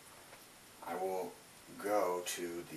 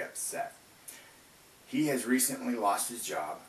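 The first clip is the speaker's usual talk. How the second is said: louder formal read-aloud voice; 3 semitones higher, 7 dB louder than usual. Acted. Reads case scenario aloud.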